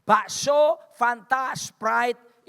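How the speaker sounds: loud, punched-out items; emphatic list. Real, not acted. Preacher lists humble luxuries to congregation, emphatic.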